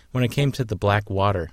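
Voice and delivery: ordinary delivery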